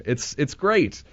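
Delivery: ordinary delivery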